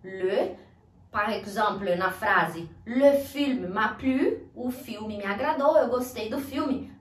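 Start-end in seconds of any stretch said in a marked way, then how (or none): 2.9-4.5 "Le film m'a plu" slow with rounded "le"; careful repeat for students; slow model phrase. Shown as a demonstration. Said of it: "o le".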